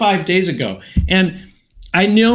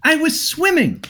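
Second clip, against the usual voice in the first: much higher, exasperated "swimming"; retold angry protest; 9 semitones higher than usual. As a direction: pitch it up in disbelief. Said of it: "I was just so angry".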